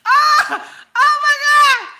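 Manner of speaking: high, loud scream of disbelief; shocked outburst. Acted. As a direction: shriek it out, floored by the news. Acts out shock at story.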